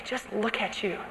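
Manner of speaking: soft sneering voice in the ear; quiet scornful jab; hushed accuser. Retold or imitated. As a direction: murmur it with contempt. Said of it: "voices in your ear that say".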